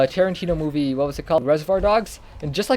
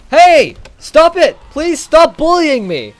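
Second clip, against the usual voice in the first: much higher, loud, slow protest; real yell; 12 semitones higher, 10 dB louder, slower than usual. Real, not acted. Player being attacked in the game, yelling to stop.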